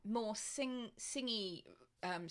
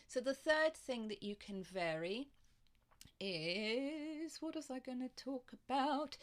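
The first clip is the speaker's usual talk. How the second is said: ordinary delivery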